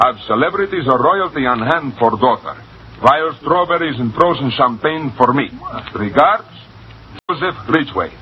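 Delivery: cablegram read in put-on Russian accent. Young American finishing the cablegram audition, Russian accent. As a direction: keep the Russian accent to the signature. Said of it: "A Russian accent".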